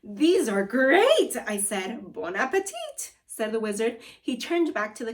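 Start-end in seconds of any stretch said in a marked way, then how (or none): 0.0-1.4 delighted. Acted. Kid enjoying food. 2.1-3.1 French flourish. Acted.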